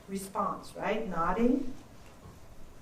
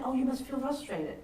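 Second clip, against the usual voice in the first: empathetic reflection; 8 dB quieter than usual. Shown as a demonstration. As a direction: gently reflect their frustration back. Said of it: "and they said".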